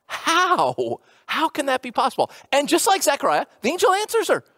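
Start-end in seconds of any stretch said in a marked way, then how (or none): 0.1-2.3 amazed question. Retold or imitated. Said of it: "she asks the question".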